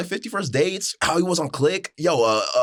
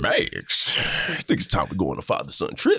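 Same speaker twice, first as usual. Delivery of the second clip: very low, scary take on a cartoon dad; a cartoon father turned ominous, pitched deep; Goofy. Goofy inviting his son on a trip, scary deep voice. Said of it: "Oh, you just made him scary".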